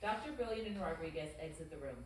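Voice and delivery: normal talking voice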